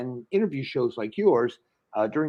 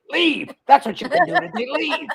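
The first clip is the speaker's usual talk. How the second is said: exasperated yell; 13 semitones higher, 4 dB louder than usual. Retold or imitated. Wife snapping at bored husband.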